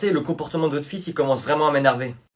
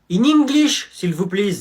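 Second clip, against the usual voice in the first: higher, louder In English; teacher insisting on English, higher and louder; shrill teacher insist; 5 semitones higher, 4 dB louder than usual. Acted. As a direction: demand English again, shrill.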